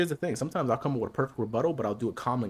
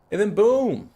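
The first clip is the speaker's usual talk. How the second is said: excited boom; 9 semitones higher, 8 dB louder, slower than usual. Real, not acted. Punchline exclamation.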